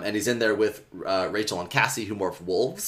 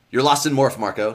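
usual speaking voice

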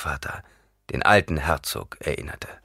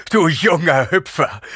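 slow, higher, louder sobbing cry; 10 semitones higher, 7 dB louder than usual. A narrator's reading. Gurney embraces Paul, sobbing with joy. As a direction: cry it out overcome with emotion. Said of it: "schluchzte Gurney".

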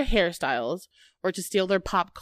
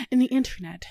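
Midwestern accent; 5 semitones higher than usual. Shown as a demonstration. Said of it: "It's really bad".

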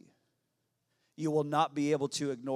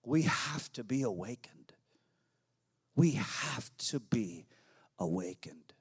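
whisper; 3 semitones lower than usual. Real, not acted. Sudden drop after shouting.

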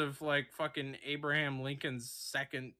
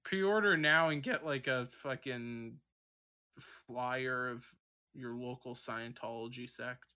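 infomercial; 6 semitones lower, 3 dB quieter than usual. Pretend. Mock ad pitch, slowing.

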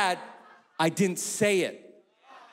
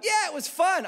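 put-on much higher, breezy child; chirpy little girl; 13 semitones higher than usual. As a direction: toss it off like a happy kid. Daughter answers dad.

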